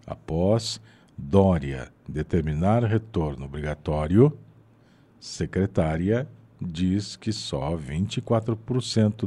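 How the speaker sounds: slow, spaced out, a pause at every word; slow spaced reading. Shown as a demonstration. Teacher showing the man how to read with pauses, slow and spaced. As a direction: read it slowly, leaving room between the words. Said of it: "Faz isso como exercício".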